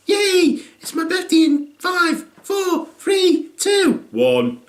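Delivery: high, mock giddy countdown; excited kid countdown. Son counting down to his thirteenth, giddy. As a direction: count down like a thrilled little kid.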